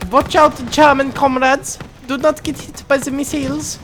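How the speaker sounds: high, hammed-up German accent; mock German accent. German soldier warns his comrades, high mock German accent. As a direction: warn the troops in a cartoon German accent.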